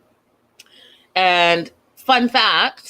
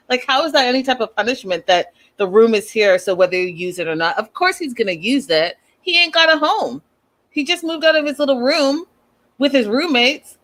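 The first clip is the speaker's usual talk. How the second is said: ordinary talk, nothing marked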